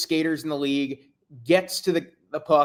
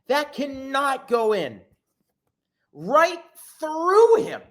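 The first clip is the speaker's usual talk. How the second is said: stunned disbelief; 8 semitones higher, slower than usual. Real, not acted. Fan disbelief at goal.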